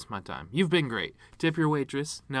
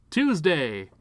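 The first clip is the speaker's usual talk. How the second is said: punchline shout; 5 semitones higher, 7 dB louder than usual. Acted.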